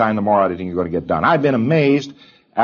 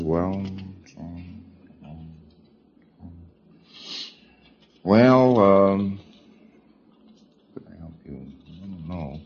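fumbling preclear; 4 semitones lower, 5 dB quieter, slower than usual. Retold or imitated.

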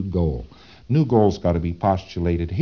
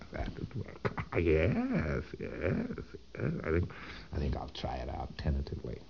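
sly whisper; 5 semitones lower, 16 dB quieter than usual. Retold or imitated. Thetan plotting, chuckling.

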